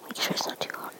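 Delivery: very soft whisper. Real, not acted. Mother checking baby's food, whispering. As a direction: murmur it under your breath.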